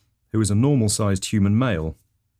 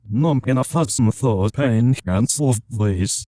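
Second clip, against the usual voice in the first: put-on backwards Scottish accent; 3 semitones higher, 4 dB louder than usual. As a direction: speak Scottish as if played in reverse. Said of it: "spoke in sort of backwards Scottish accent".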